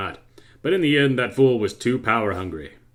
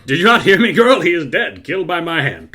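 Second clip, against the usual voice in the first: loud, high cackling gloat; gloating villain laugh; 7 semitones higher, 8 dB louder than usual. Acted. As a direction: laugh it out triumphantly.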